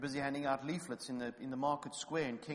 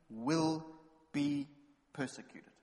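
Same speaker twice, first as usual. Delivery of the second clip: WILL and BE hit louder, slow with pauses, persecuted dropped; emphatic; 5 dB louder, slower than usual. Shown as a demonstration. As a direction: spell out "will be persecuted" word by word.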